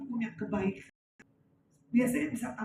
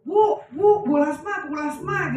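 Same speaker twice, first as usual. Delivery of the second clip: retold shouting; 3 semitones higher, 7 dB louder, slower than usual. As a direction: call out loudly, easing off on the repeats. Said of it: "katanya teriak-teriak". (teriak memanggil minta tolong)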